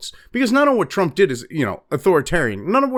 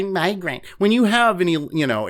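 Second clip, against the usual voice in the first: usual speaking voice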